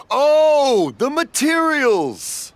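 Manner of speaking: finally getting it, overjoyed; excited realization. Acted. Deaf old father finally understanding his daughter, excited. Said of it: "finally got the message across".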